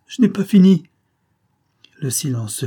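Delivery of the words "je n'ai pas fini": 1 urgent cry. A narrator's reading. Daughter cries out to finish.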